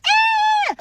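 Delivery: sudden high scream of frustration; furious scream at the farmers who voted him back in; frustrated shriek. Pretend. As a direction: let out a sharp, shrill cry of exasperation. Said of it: "Now I'm like".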